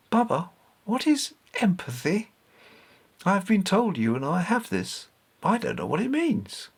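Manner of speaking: put-on innocent, puzzled child question; curious young boy. Young Richard asks Baba.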